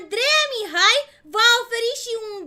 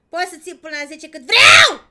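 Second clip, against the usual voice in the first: loud shout into the mic; 3 semitones lower, 12 dB louder than usual. Real, not acted. Streamer yelling too loud into the mic, shouting. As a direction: yell the question out. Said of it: "Dați-mă mai încet că urlu".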